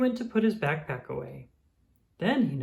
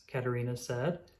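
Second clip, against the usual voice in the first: ordinary delivery, nothing marked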